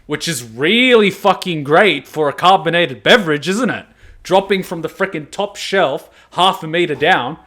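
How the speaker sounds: mock praise for shaken soda cans; sarcastic. Real, not acted. Complaining about vending machines dropping cans, sarcastic.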